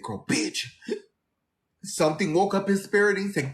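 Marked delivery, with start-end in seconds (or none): 0.0-0.7 retold half-whisper; hushed confiding. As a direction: lean in and hush it like gossip. Friend's secret news, hushed.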